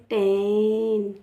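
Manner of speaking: टेन stretched very slowly, vowel held; slow teacher's टेन; drawn-out teaching demo. Shown as a demonstration. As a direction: sound out टेन slowly for the class. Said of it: "ऐसे T E N".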